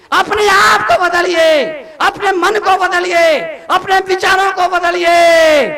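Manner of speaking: high, forceful sermon shout; preaching at full force. Real, not acted. Monk urging families to change themselves, full-force preaching.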